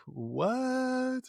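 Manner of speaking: long, high disbelieving what; stunned what. Retold or imitated. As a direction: stretch it out high, shocked. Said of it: "And I'm like".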